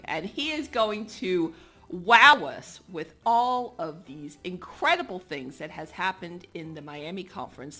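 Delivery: usual speaking voice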